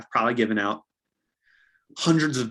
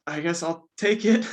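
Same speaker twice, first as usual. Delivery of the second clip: reluctant buyer; 6 semitones higher than usual. Retold or imitated. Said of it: "And then they just".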